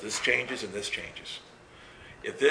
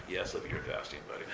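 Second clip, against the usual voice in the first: grudging; 5 semitones lower, 9 dB quieter, faster than usual. Retold or imitated. Grudging agreement.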